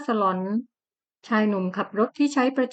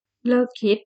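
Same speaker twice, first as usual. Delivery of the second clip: curt; much slower than usual. Acted. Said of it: "รัชตะสวนทันควัน".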